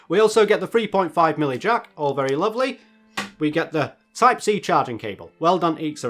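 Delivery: ordinary talk, nothing marked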